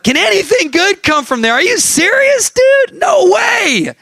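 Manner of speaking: very high, hammed-up incredulous shout; blunt New England disbelief. Nathanael scoffing to Philip about Nazareth, shrieking disbelief. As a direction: fire off outraged questions at a high pitch. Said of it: "New England, you know".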